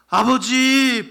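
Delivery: loud, high, slow cry of Father. Acted. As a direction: call out with all your heart. Preacher cries out to God.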